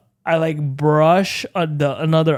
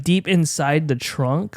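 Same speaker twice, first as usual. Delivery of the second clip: usual speaking voice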